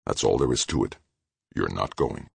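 low-pitched, softened final word; low, hard, final. A narrator's reading. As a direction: end it there, low and immovable. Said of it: "his voice low, hard, final".

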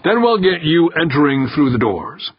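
mildly projected instruction to someone across the room; calling out. A narrator's reading. Director cueing the waiting candidate, calling out. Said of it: "Berkowitz called to him".

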